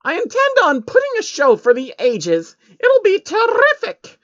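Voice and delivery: proud and delighted; beaming Trixie. A narrator's reading. Trixie beams as she tells Soros her destination.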